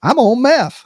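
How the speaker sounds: mild put-on voice of the bike guy; calm dude. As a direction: toss off the confession casually, voicing him up high. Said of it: "He goes".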